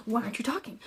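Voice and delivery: quiet pushy question. Retold or imitated. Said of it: "he's just being so aggressive about it".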